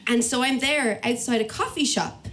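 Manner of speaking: ordinary talk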